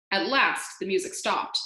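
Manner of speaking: plain reading voice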